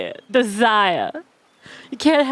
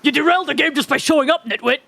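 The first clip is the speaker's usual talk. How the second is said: gruff Chopper; 3 semitones higher, faster than usual. Acted. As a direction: snap at the nitwit in a brisk character voice. Chopper character line.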